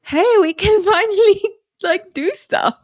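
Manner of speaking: high, loud and gleeful, laughing as she says it; gleeful friend. Retold or imitated. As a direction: squeal it like a delighted friend. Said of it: "And she's like".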